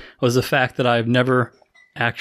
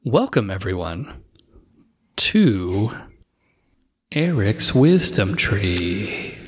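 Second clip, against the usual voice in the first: show intro; 6 semitones lower, much slower than usual. Acted. Opening the show.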